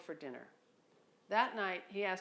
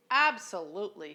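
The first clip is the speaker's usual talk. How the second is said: put-on a loud burst in a Boston accent; 11 dB louder than usual. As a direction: blurt it out loud, Boston style. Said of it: "he said in his loud Boston accent".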